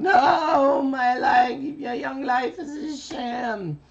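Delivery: very high, loud wailing cartoon voice; high hysterical little kid meltdown; Carbonate voice. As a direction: wail it out, building to a crying shout. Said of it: "he's going".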